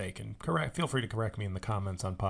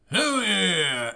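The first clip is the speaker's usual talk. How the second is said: louder and higher whooping mock cheer; 9 semitones higher, 8 dB louder than usual. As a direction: whoop it like a dumb action hero. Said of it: "And then Brad Pitt goes".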